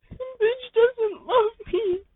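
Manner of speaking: very high, slow wailing complaint; high slow crying whine. Acted. The listener moping alone in a slow high wail. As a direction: whimper it out slowly.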